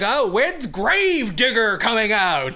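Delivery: high, drawn-out eager shout; excited fan. Retold or imitated. Fan at a county fair asks for his favorite truck, excited. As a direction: call out like a thrilled monster truck fan.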